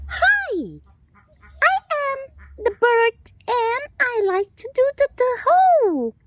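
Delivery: high, chirpy little bird voice throughout; chirpy pet bird voice. Acted. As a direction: play the bird proudly introducing itself. Bird character voiced again.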